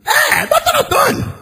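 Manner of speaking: high humble voice of the judge; the judge on the phone, humbly confirming his name in a high voice; judge's voice. Retold or imitated.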